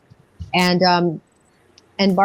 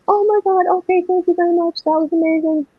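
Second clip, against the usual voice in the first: high-pitched retold awe, lightly overwhelmed; cowering awe; 10 semitones higher, faster than usual. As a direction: reenact being awed and cowed, voice lifted high. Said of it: "I was cowering in fear".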